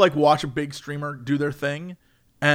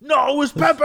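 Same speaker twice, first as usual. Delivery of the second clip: high, mock-outraged wail; hammed-up indignant protest; worked-up chatters; 9 semitones higher, slower than usual. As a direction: imitate chat getting all worked up. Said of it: "They get, like, all worked up".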